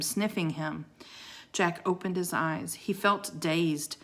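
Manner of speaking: usual speaking voice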